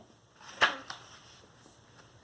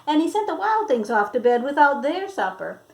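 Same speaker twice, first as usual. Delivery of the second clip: usual speaking voice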